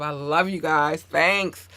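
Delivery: ordinary talk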